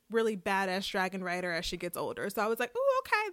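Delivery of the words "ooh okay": high-pitched "ooh" of discovery; impressed surprise. Retold or imitated. Viewer noticing Baela's fierce personality, high impressed surprise. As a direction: react high, pleasantly taken aback. Retelling her reaction to the show.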